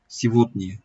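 "Sivotny" with the first E said as I and the end A as a short I; teacher models the reduced vowels in "Sivotny"; Russian word with reduced vowels. Shown as a demonstration. Teacher demonstrates "Sivotny" to the class, vowels reduced to I. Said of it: "İ olarak okundu".

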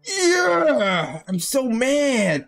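usual speaking voice

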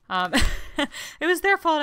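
ordinary talk

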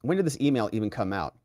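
ordinary talk

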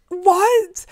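high, loud outraged yelp; angry disbelief. Retold or imitated. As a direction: burst out in indignant disbelief. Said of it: "I was so angry".

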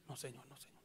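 whispered refusal to the Lord; fading plea. Retold or imitated. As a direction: shrink away from it, whispering. Retold reply, fading.

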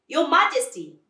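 loud, high address; appealing tortoise. Acted.

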